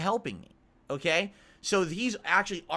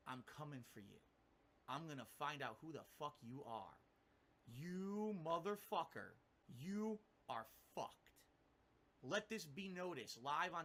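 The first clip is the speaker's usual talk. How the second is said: much softer, slow, seething threat; quiet menacing threat; 15 dB quieter than usual. Pretend. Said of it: "this is what he's gonna say".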